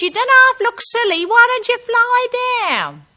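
high, loud jeer called upward; high-pitched yell across a distance; shouted taunt. Acted. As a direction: holler it up, dropping on the last word. Shouted exchange from ground to air.

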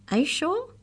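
high, demanding question. A narrator's reading. Maury questions the canine, demanding. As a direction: demand an answer, pitch leaping up. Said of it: "he demanded".

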